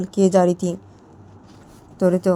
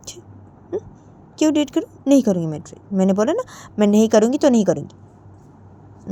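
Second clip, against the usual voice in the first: usual speaking voice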